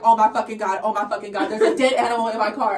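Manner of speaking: loud imitation of a horrified friend; retold scream. Friend finding a dead animal in car, retold scream. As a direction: blurt it out loud, acting her horror. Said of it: "I hear a scream from inside the car".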